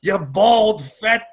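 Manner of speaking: high, drawn-out hammed-up yelling; mock angry tirade. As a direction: hurl insults in a pretend outburst. Acts out his angry comeback to Dana.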